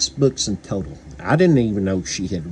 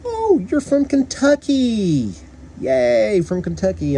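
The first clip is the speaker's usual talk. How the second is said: high, slow mock girl's voice; imitating a girl; 12 semitones higher, slower than usual. Girl in VR paintball spotting his Kentucky accent, high mock voice. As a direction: squeal it up high like the girl in the game. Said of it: "this one speaks up and she says".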